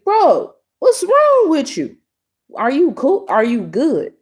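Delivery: high-pitched, exasperated retort; incredulous confrontation. Retold or imitated. Woman confronts the stranger who yelled at her, retold exasperation. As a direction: act out baffled disbelief, starting very high and easing down.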